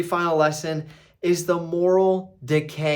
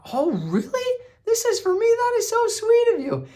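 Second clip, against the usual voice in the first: much higher, imitating a gushing wife; mock sweet gush; 3 dB louder than usual. Wife thanks husband for the house, mock sweet gush. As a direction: play her thrilled and touched, hammed-up. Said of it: "And she's like".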